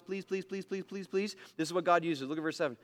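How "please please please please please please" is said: soft, higher-pitched begging please; playful pleading repetitions. Real, not acted. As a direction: coax them gently to open their Bibles. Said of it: "Please look at your Bibles".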